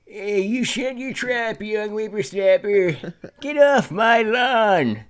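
put-on high, slow cranky old geezer; Old Man Jenkins. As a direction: scold like a grumpy old man yelling at kids. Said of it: "Old Man Jenkins, everybody".

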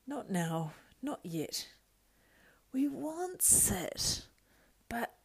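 high whining creature voice shifting to hissed whispers; Gollum voice. Acted. Gollum arguing with himself, wheedling then whispering. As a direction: wheedle and hiss like a split creature.

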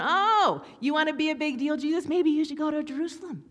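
high, loud sneering taunt at Jesus; sarcastic mocking. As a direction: taunt him like a scornful brother. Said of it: "They're mocking him".

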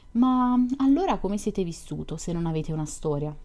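plain reading voice

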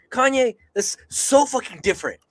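high, heated outburst; getting mad. Real, not acted. Arguing back at [name] over his comparison, getting mad. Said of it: "I'm getting mad".

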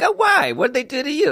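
very high-pitched playful whine; mock protest. Royal fan answering people who don't care, mock protest. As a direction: protest in a high, put-on plaintive voice. Acts reply to royal family doubters.

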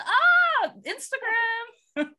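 high, loud hammed-up "aah", then drawn out; mock outburst. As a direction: cry it out in pretend frenzy. Mock outburst in kidnapping bit.

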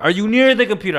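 mock phone yelling at grandma. Retold repeat louder to grandma on phone.

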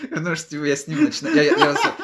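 high, loud, laughing through words. Real, not acted. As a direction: talk while cracking up. Laughing while telling.

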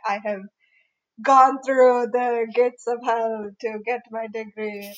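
a playful put-on Indian accent. Imagining a battle-hardened senior, in a jokey Indian accent. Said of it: "slipping into my Indian, like, accent".

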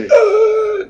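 high mock yell from a nightmare; half-laughing fake scream; mock dream scream. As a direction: shout it like you're screaming in your sleep. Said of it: "estoy gritando".

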